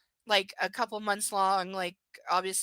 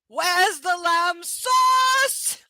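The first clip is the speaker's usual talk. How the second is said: slow, high and loud British chef yell; British male impression; 7 semitones higher, 8 dB louder, much slower than usual. Streamer imitating a British TV chef for viewers, British accent yell. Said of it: "That's me trying to do British and male".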